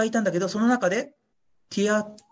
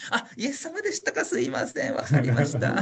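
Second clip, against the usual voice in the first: laughing embarrassment; 4 semitones higher than usual. Pretend. Embarrassed at not recognizing Jesus.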